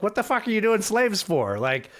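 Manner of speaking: high, incredulous mock outburst. Union soldiers riding up to Galveston, comically incredulous.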